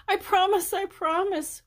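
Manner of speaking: high, loud pleading wail. A narrator's reading. Frightened girl in the cave, pleading with Henry, wailing. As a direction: beg through tears. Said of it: "wailed Denise".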